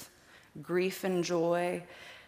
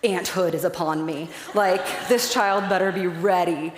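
thrilled; 7 dB louder, slower than usual. Retold or imitated. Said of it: "I was like".

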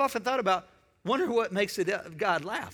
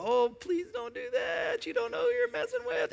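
squeaky kid's mock warning to strangers; 4 dB quieter than usual. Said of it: "I'd go".

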